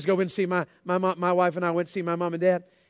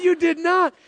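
mock disbelief; 9 semitones higher, 4 dB louder than usual. Repeated disbelief at dad.

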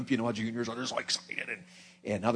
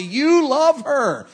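much higher and louder, drawn out slowly; preaching climax; 13 semitones higher, 10 dB louder, much slower than usual. Real, not acted. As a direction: hammer home the last words from the pulpit. Preacher driving home point.

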